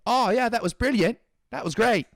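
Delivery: high, loud hammed-up British pundit gushing; mock British commentator. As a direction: ape an empty English commentator, overexcited. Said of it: "no offense for the accent there".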